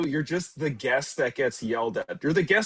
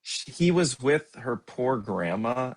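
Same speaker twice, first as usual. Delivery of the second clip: usual speaking voice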